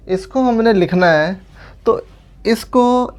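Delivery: ordinary delivery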